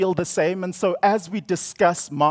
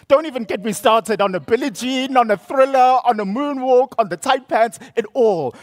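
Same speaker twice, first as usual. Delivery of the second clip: higher, louder, rattling off favourites with glee; excited gushing; 8 semitones higher, 5 dB louder than usual. Real, not acted. Speaker to audience about idolising [name], excited gushing. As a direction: gush like a devoted fan.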